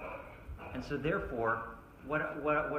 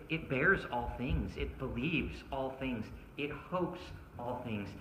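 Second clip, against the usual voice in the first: soft, reverent reading voice; scripture reading; 4 semitones lower, 7 dB quieter than usual. Acted. Pastor reads First Corinthians to closed-eyed listeners, softly. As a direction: read the verses gently to a listening congregation.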